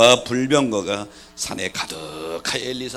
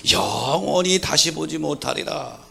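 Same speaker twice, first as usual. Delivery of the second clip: loud preacher cadence; preaching; 4 semitones higher, 11 dB louder than usual. Real, not acted. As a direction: proclaim the verse boldly. Preaching at full force.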